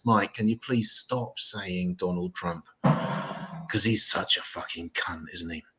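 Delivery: a hint of an old woman, slow and low; mild old lady impression, slow; lightly voiced old woman. An old woman complaining to the comic, lightly voiced and slow. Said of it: "this old woman with two kids comes up".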